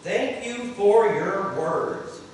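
slow, loud prayer line to echo; leading prayer, slow. Shown as a demonstration. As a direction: say it slowly for others to repeat. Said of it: "everyone repeat after me".

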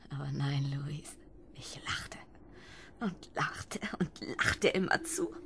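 breathy whisper; whispered confession. Acted. Wife whispering to Louise about laughing.

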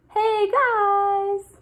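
high, loud bright call; eager wake-up call. Acted. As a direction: call out at dawn again. Snail wakes next morning.

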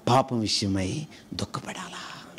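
breathy hushed whisper; whispered sorrow over sin. Acted. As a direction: drop to a whisper for weight. Preacher drops to a whisper.

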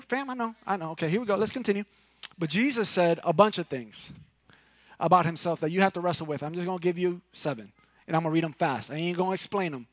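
usual speaking voice

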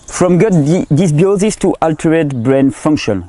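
slow, careful English with a strong French accent. Real, not acted. Lecturer reading an English study title aloud in French-accented English. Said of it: "mon accent anglais".

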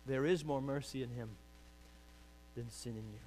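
ordinary talk, nothing marked

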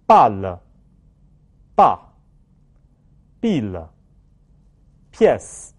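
"bal，ba，bil，pièce" very slowly, one by one; "bal，ba，bil，pièce" as separate falling drill words; read-after-me words. Shown as a demonstration. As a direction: model each French word loudly, long pauses between. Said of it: "放在单词里面跟我读一遍".

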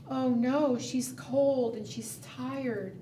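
plain reading voice

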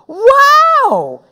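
loud, high, drawn-out wow; whooping awe; thrilled amazement. Acted. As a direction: shout it in sheer delight. Henry thrilled by the heads, repeats.

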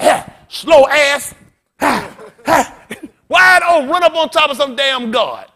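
loud, high, shouted preaching; fiery preacher climax; preaching at full force. Acted. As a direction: build the sermon to a roar.

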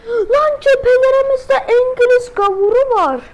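high, loud warning yell; alarmed shout. Real, not acted. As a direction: raise the alarm. Alarm at enemy on front.

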